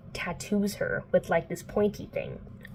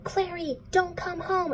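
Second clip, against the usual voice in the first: high, strained plea down the phone; retold alarm in a high voice; mock urgent warning; 12 semitones higher than usual. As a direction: act out a panicked mom's warning. Retold mother's warning phone call.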